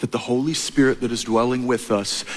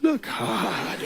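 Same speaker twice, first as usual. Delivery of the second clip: softer, hushed and knowing; hushed sage; 7 semitones higher, 9 dB quieter, much slower than usual. Retold or imitated. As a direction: lean in and hush it. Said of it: "And Rafiki says".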